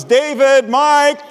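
a mother hollering her sons' names; mom calling out. Retold or imitated. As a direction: call the boys in like a mom. Mom calling kids to dinner.

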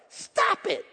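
self-scolding. Pretend. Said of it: "you just gotta tell yourself".

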